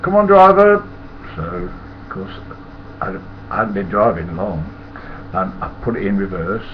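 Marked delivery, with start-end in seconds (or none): 0.0-0.8 loud shout across the yard; loud impatient call to the driver. Retold or imitated. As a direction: bellow it like a workman hurrying you. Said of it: "the bloke shouted".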